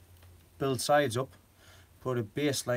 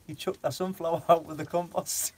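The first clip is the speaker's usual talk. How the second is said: laughing through the words; can barely get it out for giggling; real giggles breaking up the line; 5 semitones higher than usual. Real, not acted. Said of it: "I was killing myself laughing".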